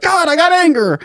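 very high, strained mock outburst; angry freak-out. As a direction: imitate a teen blowing up, pitch way up. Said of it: "I'd freak out".

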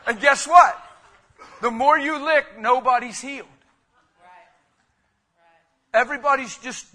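loud, high preaching climb; preaching at full force. Acted. Pastor warning the church about sympathy groups, full force. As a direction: hammer the picture home to the congregation.

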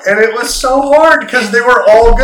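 high, loud laughing talk. Real, not acted. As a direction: laugh through the words. Laughing about the theme songs.

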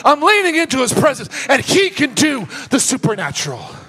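loud, high sermon climax; full-force preaching. Real, not acted. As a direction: drive it home like a revival preacher.

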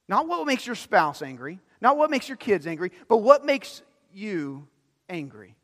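ordinary talk, nothing marked

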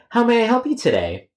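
very high, sugary agent greeting; chirpy phone greeting. Acted. Agent greets the caller in a very high sweet voice. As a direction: put on a bright customer-service voice.

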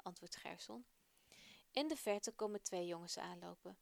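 usual speaking voice

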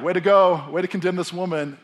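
hammed-up approval; mock congratulation. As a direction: open with a big cheer. Mock praise Jesus does not give.

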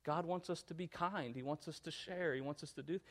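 very soft, patient parent; hushed fatherly talk, acted out; gentle explaining. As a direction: explain it quietly and tenderly to a child. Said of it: "and I explain deeper".

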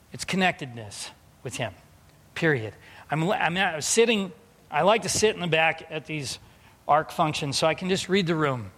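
ordinary talk, nothing marked

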